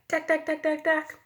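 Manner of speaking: high, hammering duck duck; jackhammer. A narrator's reading. Teacher imitates men digging with a jackhammer, hammering. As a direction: bang it out like a jackhammer. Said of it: "hands over my ears".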